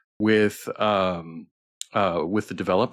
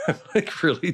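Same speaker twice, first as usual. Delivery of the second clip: laughing; 12 semitones higher than usual. Real, not acted. Laughing about being tired.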